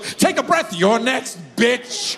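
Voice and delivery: high, sarcastic mock echo; high-pitched sneering imitation; mocking mom reply. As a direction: throw the words back mockingly. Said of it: "My mom goes".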